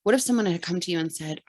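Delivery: ordinary talk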